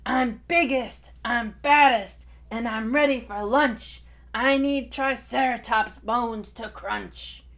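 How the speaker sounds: loud, swaggering T. rex brag; boastful dinosaur. Acted. Tai boasts to the hiding dinosaurs, loud swaggering roar. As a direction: puff up and boom each boast.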